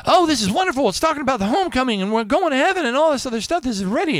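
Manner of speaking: high, fast, gushing excitement; over-the-top gushing, mocking naive believers. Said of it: "most people go like".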